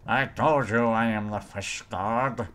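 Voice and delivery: put-on burbling fish-god voice. Player boasting as the fish god, put-on creature voice. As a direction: declare it as a silly water creature.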